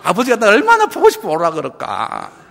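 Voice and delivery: loud, high, exclaimed wonder; acted eagerness. Preacher acts Daniel longing to meet God, eager exclamation. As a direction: marvel aloud at being called by the Father. Acting eager welcome of death.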